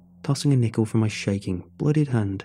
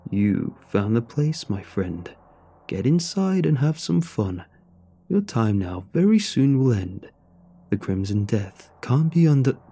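ordinary delivery, nothing marked

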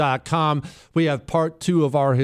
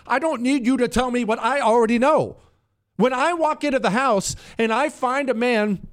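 rising, raised-pitch exasperated rant; exasperated host building to a peak; heated rant; 7 semitones higher than usual. Real, not acted. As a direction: let the frustration boil over, voice climbing. Escalating rant, higher pitch.

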